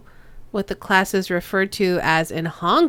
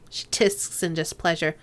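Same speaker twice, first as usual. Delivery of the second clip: ordinary delivery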